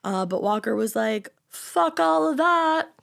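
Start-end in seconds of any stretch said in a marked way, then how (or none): 1.5-2.9 loud, high, drawn-out mock refusal; emphatic refusal. Stepson rebuffing the man who wanted to adopt him, defiant. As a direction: slowly punch out a defiant brush-off.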